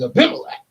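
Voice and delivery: Abimelech thrown up high; emphatic name. Shown as a demonstration. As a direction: hit Abimelech with exaggerated emphasis. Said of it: "You say that name with some emphasis".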